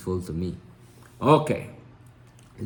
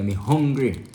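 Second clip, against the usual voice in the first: Creole phrasing, raised and louder; Jamaican Creole; 5 semitones higher, 6 dB louder than usual. Shown as a demonstration. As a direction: say it the island way. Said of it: "You can say".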